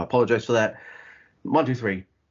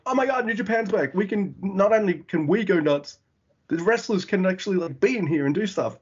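excited fan; 7 semitones higher than usual. Retold or imitated. Crowd voiced, excited fan.

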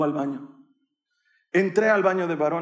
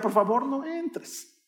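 high, slow pleading that rises at the end; whimpered plea; 8 semitones higher, slower than usual. Retold or imitated. Boy begging his mother not to come in, whimpered pleading. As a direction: beg weakly as an embarrassed child.

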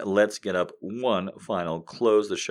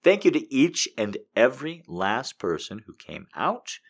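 ordinary delivery

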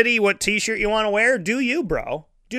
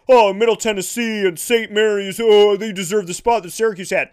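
mock whiny critic; 3 semitones higher, 3 dB louder than usual. Mocking critics' complaint.

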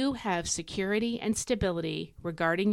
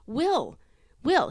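"will" said on its own with a high, falling punch; "will" as a firm, standalone promise; emphatic WILL; 6 semitones higher, 3 dB louder than usual. Shown as a demonstration. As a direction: drive home the single word "will". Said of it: "emphasize that word will".

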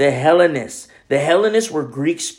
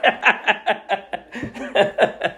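laugh; 6 semitones higher, 4 dB quieter than usual. Real, not acted. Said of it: "where's my wife from again".

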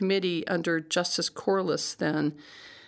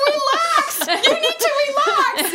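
much higher, louder boss voice, slowed and laughing; imitating boss; 7 dB louder, slower than usual. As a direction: ham up the boss telling her to calm down. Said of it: "He's like".

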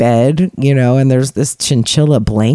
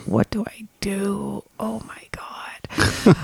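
slow, hushed, panicked whisper; 5 semitones higher, 9 dB quieter, much slower than usual. Retold or imitated. Caught staring by the husband, slow panicked whisper. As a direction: whisper in a fluster, slowly. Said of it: "I was like".

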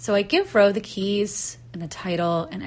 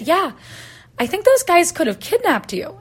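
higher, louder retold alarm; alarmed agreement; 10 semitones higher, 6 dB louder than usual. Rake guy telling her about the danger, alarmed. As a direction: press the danger home, quoting him. Acted alarm after the scare.

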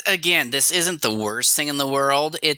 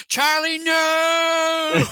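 screaming; much slower than usual. Pretend. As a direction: scream his name in terror. Acting a movie scream.

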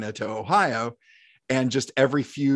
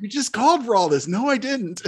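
confused protest; 9 semitones higher than usual. Retold or imitated. Rookies confronting the sergeant.

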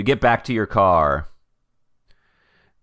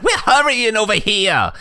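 high frantic voice; 13 semitones higher than usual. Acted. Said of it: "He's like".

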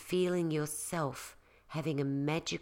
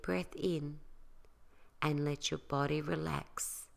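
soft spa whisper; 2 semitones lower, 7 dB quieter than usual. Acted. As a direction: talk them gently into calm, softer. Leads a guided relaxation.

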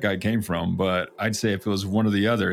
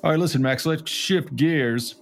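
mock announcer; 5 semitones higher than usual. Abrupt segue, higher and slow.

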